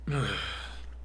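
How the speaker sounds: long tired exhale, soft; weary sigh. Acted. Teacher sighing at complaining students, weary and soft. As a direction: sigh as if bored of them.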